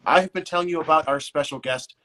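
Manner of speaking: upbeat radio announcer read, building in pitch; radio host intro. Acted. Host opens radio portion introducing special guest.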